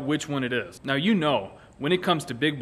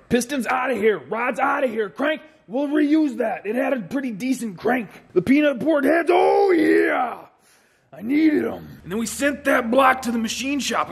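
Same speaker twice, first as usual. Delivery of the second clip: getting worked up; 6 semitones higher than usual. Acted. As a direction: pump up the crowd, voice climbing high. Worked up hyping engine parts.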